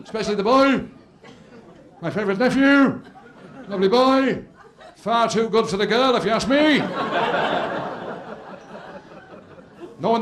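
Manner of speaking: put-on pompous drunk uncle running his mouth; drunk uncle toasting. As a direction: uncle insulting the bride's family in his toast.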